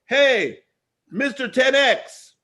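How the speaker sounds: high, loud hail across the street, retold; imitated yell. Stranger at the restaurant hailing him, loud and high. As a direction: call out like a fan spotting a celebrity. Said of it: "a guy screams at me".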